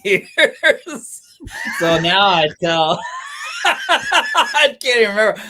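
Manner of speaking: words spilling out between laughs; laughing hard while trying to remember. Real, not acted. Laughing while recalling stories.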